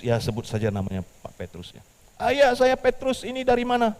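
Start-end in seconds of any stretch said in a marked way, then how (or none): 2.2-3.9 higher, louder surprised answer; surprised caller. Acted. Petrus answering the bank's phone call, surprised. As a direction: perk up at a strange call.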